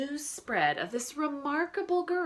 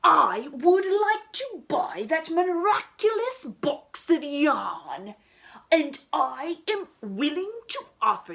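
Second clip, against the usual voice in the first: loud, high haughty archduke voice; grand archduke bargaining; pompous archduke; 7 semitones higher, 10 dB louder than usual. Acted. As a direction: offer it like a rich, pompous nobleman. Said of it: "said the Archduke".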